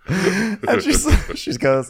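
ordinary talk

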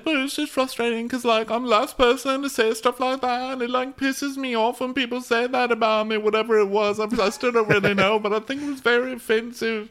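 high whiny imitation venting; squeaky parody of an upset woman; mock tearful. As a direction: complain in a put-on weepy falsetto.

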